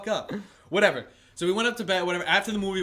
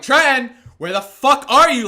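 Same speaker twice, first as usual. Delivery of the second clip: high, loud frantic call; re-enacted panicked search shout; yelling; 7 semitones higher, 12 dB louder than usual. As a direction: yell for someone who is missing, retelling it. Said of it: "Like, yelling, like".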